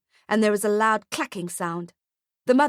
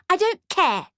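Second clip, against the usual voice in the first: put-on much higher, defiant child; bratty girl voice; 10 semitones higher, 4 dB louder than usual. A narrator's reading. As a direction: snap it like a brat.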